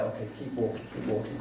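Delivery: 숨죽여 혼잣말하듯 keep walking; head down, muttering under the breath; quiet to self. Retold or imitated. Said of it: "I'm like".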